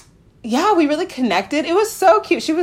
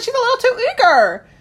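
higher, lightly alarmed; mock nervous aside about her; a touch of nervous worry; 13 semitones higher, 3 dB louder than usual. Worried about an eager match, voice higher. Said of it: "I was really scared at first".

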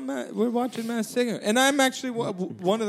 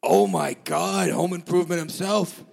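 Rupert voice; 7 semitones lower than usual. Pretend. [name] holding Rupert voice.

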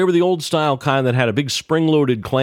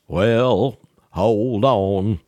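lightly voiced, unhurried old Carolina man; a hint of Earl's slow, deliberate phrasing; Earl's slow talk; much slower than usual. Earl on the phone to Ned, lightly voiced slow drawl. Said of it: "Earl spoke very slowly and deliberately".

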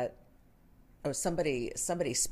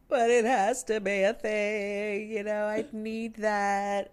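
put-on higher, slow pleading child voice; imitating son; 7 semitones higher, 4 dB louder, slower than usual. Son begging to sleep in the living room, slow high pleading. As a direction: plead like a kid who needs it. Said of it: "And he said".

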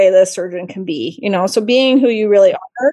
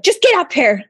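higher, impatient mock order; retold yelling; 8 semitones higher, 3 dB louder than usual. Rude caller cutting off questions, imitated yelling. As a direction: imitate an exasperated yell down the phone. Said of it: "They're yelling at me".

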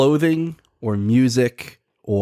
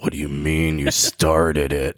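imitating incredulity, low and slow; mock disbelief; 7 semitones lower, slower than usual. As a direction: draw it out as a stunned coworker. Retelling a coworker's shocked reaction.

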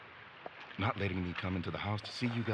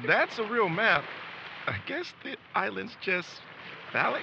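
much higher, louder indignant insistence; flustered protest; 11 semitones higher, 6 dB louder than usual. Acted. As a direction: defend yourself, embarrassed and loud. Defending the map, flustered.

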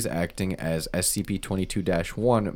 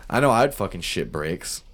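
normal talking voice

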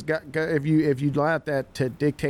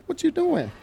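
mock surprised coach. Coach confronting kid on sideline.

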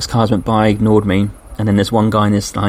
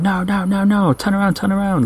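mock farmer yelling, pitched much higher; high-pitched retold yell of turn around; retold shout; 10 semitones higher than usual. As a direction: imitate frantic farmers waving you back. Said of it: "And then they shouted".

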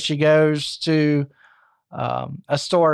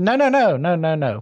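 breezy protest. Retold or imitated. Husband waves off wife's suggestion.

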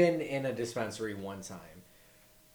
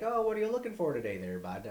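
put-on high, fast friendly Canadian accent; breezy Canadian salesman pitch; Canadian dude; 7 semitones higher, faster than usual. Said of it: "And they're like".

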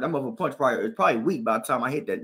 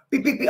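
higher beep punch noises; punch sounds; 10 semitones higher than usual. Retold or imitated. Fighting the Mexican again, punch noises. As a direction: rattle off punches.

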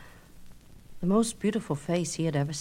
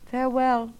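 distant echo; 6 semitones higher than usual. Acted. Echo repeats farewell.

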